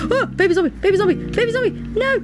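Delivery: high loud panicked yelling; frantic high squealing; panicked cries. Real, not acted. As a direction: shriek in real alarm.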